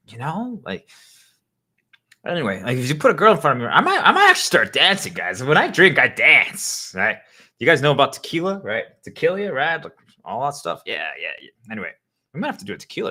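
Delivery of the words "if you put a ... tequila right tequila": tipsy excitement. Acted. Tipsy boasting about dancing.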